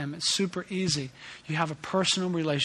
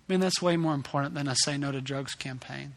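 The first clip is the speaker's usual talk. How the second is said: normal talking voice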